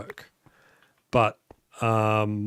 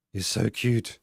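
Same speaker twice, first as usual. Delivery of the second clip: normal talking voice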